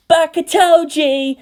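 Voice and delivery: put-on high, loud, slow kids' shout; children shouting. A narrator's reading. Children all taunt Toji at once, high loud shout. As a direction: yell it like a whole crowd of kids. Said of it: "the children all shouted at once".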